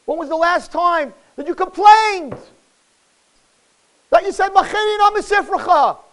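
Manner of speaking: high, loud, impassioned pleading; fervent cry from the pulpit; full-force preaching. Real, not acted. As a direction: plead with God at full volume.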